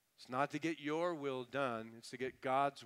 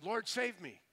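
higher-pitched quick cry for help; mild cry of a sinking man; plea for rescue; 7 semitones higher than usual. Retold or imitated. Peter sinking in the sea calls to the Lord, mild plea. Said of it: "he was sinking in the sea".

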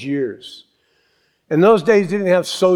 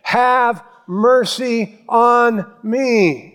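high, loud drawn-out cry for mercy; yelling; 8 semitones higher, 3 dB louder, slower than usual. Retold or imitated. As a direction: cry out slowly, stretching each word. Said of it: "He started yelling".

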